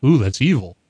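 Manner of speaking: ordinary talk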